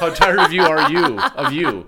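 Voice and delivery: high, loud laughing repeat; laughing squeal. Retold or imitated.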